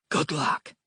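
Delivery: plain reading voice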